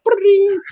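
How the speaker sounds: high trilled ringing noise; mouth-made phone bell; imitated phone ring. Imitates phone ringing in another aisle.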